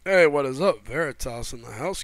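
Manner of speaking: ordinary talk, nothing marked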